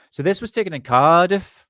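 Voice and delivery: mock accent, Cardiff said with a rise; bad put-on accent. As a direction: ham up a bad accent on the place name. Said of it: "Sorry, that was a really bad accent".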